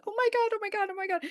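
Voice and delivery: squealing oh my god, very high; overjoyed. Retold or imitated. Mother learning of an amputee support group, thrilled squeal. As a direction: gush it out in delight.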